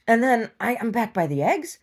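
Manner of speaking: ordinary talk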